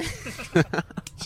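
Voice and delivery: high burst of laughter; cracking up at the memory; big laugh. Real, not acted. Laughing after auntie story.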